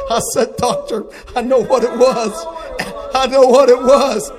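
loud, high, driving sermon cry; full-force preaching. Acted. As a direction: preach it out loud to the whole church. Preaching at full force over music.

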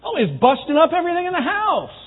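high-pitched, indignant wife imitation; upset wife. Wife reports husband's rampage to counselor, indignant. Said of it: "she's mad".

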